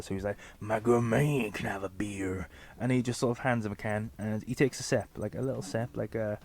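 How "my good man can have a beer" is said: slow, whispery creep voice; creepy dad voice. Acted. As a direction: ask slowly, like a dodgy creep. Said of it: "he sounds like he's on the register".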